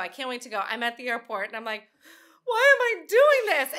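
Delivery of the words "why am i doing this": much higher, louder and slow, hammed-up dread; mock panic. At the airport before a trip, mock panicked whine. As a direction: whine it like instant regret.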